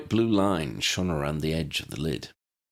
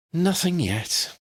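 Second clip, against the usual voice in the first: gasped; 11 semitones higher, slower than usual. A narrator's reading. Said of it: "she gasped".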